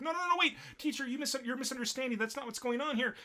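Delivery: high, squeaky panicked schoolgirl; mock-panicked pleading with a teacher; frantic protest. Pupil protesting to mistaken teacher.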